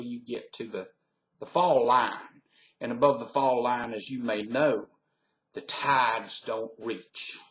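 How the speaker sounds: Virginia Tidewater Southerner explaining; Southern US accent. Shown as a demonstration. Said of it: "so that's my southern accent".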